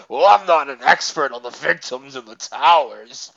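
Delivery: exaggerated mocking imitation; hammed-up sarcastic excuse-maker. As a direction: mimic him in a put-on sarcastic voice. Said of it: "in, like, a sarcastic voice".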